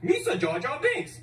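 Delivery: Jar Jar Binks voice. Pretend. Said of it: "I can imitate any character".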